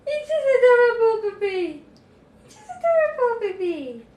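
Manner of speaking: high-pitched coo, falling at the ends. Real, not acted. Doting owner to the puppy, high adoring baby talk.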